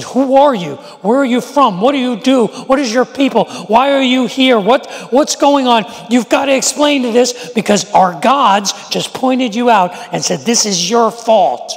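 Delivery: scared sailors demanding answers of Jonah; frantic questions. Retold or imitated. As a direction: grill him, panicked. Sailors interrogate Jonah in storm.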